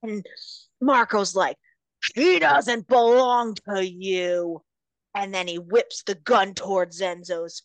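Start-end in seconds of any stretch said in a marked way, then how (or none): 2.0-4.6 very slow, lightly acted threat; a hint of a menacing villain. Villain threatening the brother, slow mock menace. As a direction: drag out the possessive threat.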